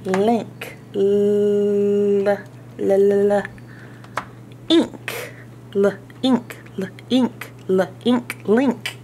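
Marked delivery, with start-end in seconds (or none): 0.9-3.4 l-l-l, held and buzzing; stretched l sound. Shown as a demonstration. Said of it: "phonics sound demo, held consonant". 4.7-8.4 "L, ink" split onion-style, onset then rime; syllable by syllable. Shown as a demonstration. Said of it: "teacher segments the word".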